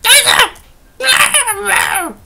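high, loud gleeful cry with a laugh; laughing triumphant shout. Real, not acted. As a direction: shout it out laughing as you spot him. Spotting him again, laughing shout.

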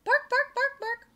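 high, loud doggy barks in words; sharp, high yapping on the word; dog barking. A narrator's reading. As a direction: bark it out like an excited little dog. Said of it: "to find a dog barking at him".

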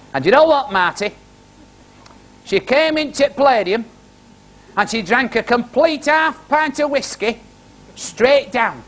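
high-pitched Barnsley Yorkshireman telling a tale; Yorkshire accent. Retold or imitated. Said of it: "have you got a good Yorkshire story".

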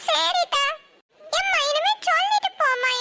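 put-on a little girl, sniffly and meek; little girl's voice. Small daughter answering her mother, in a high child's voice.

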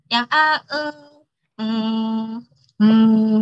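drawn-out um and uh fillers; filler sounds. Shown as a demonstration. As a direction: hesitate with filler noises. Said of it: "membicarakan filler words". (suara eh dan mm yang ragu)

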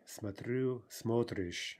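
смотрю stressed on "рю", смотришь on "смо"; end-stressed смотрю, then stem-stressed смотришь; shifting stress. Shown as a demonstration. Teacher giving the key forms, смотрю stressed last, смотришь stressed first. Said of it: "shifting stress".

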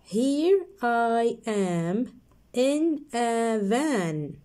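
slow, drawn-out line; slow reading. Shown as a demonstration. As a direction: stretch it out for kids. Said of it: "One more time".

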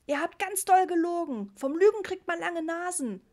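put-on high, loud cross little boy; a small kid bawling out his parents; child yelling. A narrator's reading. Ritchie yells at his parents about the rain, high and loud. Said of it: "brüllte Ritchie".

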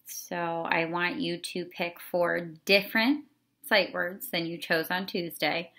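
ordinary talk

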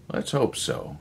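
muted and grim. A narrator's reading. Erickson to Miller, grimly doubting success. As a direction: say it without much hope. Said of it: "said Erickson grimly".

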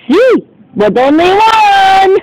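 high, slow squeaky fish voice with a cry in it; fishy voice. Pretend. Magic fish telling doggy he gets one wish, high and slow.

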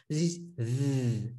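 "th" buzzed slow like the th in that; voiced th. Shown as a demonstration. Teacher shows the zal sound to students, slowly buzzed. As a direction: hold a slow buzzing "th", tongue between teeth. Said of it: "it's not like the".

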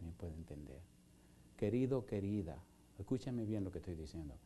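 soft, low, intimate preaching; tender altar-call appeal, hushed and earnest. Acted. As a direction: draw them in gently to come forward.

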